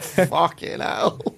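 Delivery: high, drawn-out mock disbelief; baffled bystander. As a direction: stretch it out like an onlooker taken aback. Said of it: "they look quite baffled".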